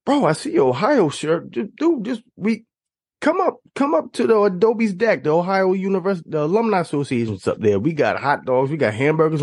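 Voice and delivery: a stranger hyped to pull him in; an alum inviting him up, excited and high; eager bro invite. Retold or imitated. Alumnus inviting him upstairs.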